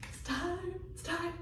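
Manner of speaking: high, hushed and eager; breathless high murmur of anticipation; hushed excitement. Retold or imitated. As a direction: whisper to yourself that the moment has come. Hears the knock, excited.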